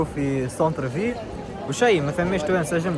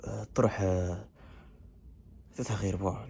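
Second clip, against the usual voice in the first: hushed voice; 5 semitones lower, 13 dB quieter than usual. Real, not acted. Early morning, people still sleeping.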